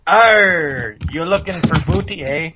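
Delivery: growly hammed-up pirate greeting. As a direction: play a cartoon pirate, arr. Pirate talk joke.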